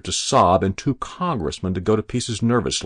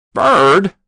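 very loud, high outburst; scornful exclamation; 12 semitones higher, 12 dB louder than usual. A narrator's reading. Hero cutting in on a senator, exclaiming. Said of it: "he exclaimed".

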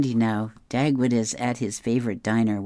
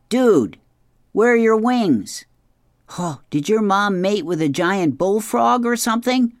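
higher, louder mocking dragon voice; taunting dragon; 9 semitones higher, 5 dB louder than usual. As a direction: jeer at him like a cocky dragon.

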